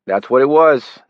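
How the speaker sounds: put-on a city stranger greets Johnny, loud Brooklyn accent; flat Brooklyn accent. A narrator's reading. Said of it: "He had a flat city accent as well".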